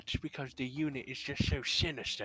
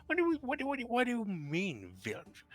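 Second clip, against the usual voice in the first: shocked disbelief; 11 semitones higher, faster than usual. Acted. Outraged disbelief at villain idea.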